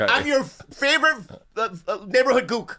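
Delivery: high-pitched, stumbling rush; hurried and faltering, pitched high. Pretend. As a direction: blurt it too fast, tripping over the words. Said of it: "Hey, slow down".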